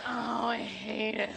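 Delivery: very slow, drawn-out groan; agonized director imitation, stretched out; muttered despair. Actress mimics director during her scene, muttered despair. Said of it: "Under his breath".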